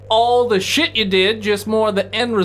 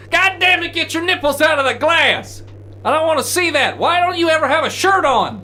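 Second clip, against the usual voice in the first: exasperated yell, voice pitched well up; angry yelling; 8 semitones higher than usual. Acted. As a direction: bellow it at the shirtless guy. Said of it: "you just hear".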